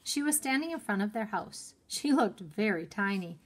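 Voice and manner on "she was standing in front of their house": plain reading voice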